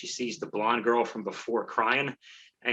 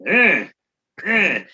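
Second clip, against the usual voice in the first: strained little grunts, the second pitched up; awkward grunting; 7 semitones higher, 7 dB louder than usual. Retold or imitated. Comic imitating a stiff dancer, strained grunts. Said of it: "She does it like, like I do".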